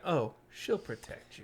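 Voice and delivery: slow, sinister version of the line; creepy mock quote. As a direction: say it like a veiled threat.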